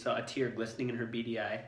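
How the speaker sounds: usual speaking voice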